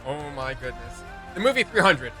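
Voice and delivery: normal talking voice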